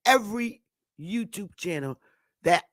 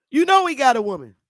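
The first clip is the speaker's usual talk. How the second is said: put-on scolding girlfriend; 6 semitones higher, 7 dB louder, faster than usual. South Wind scolding the women.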